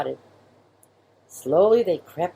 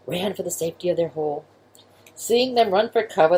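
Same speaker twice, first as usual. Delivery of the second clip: plain reading voice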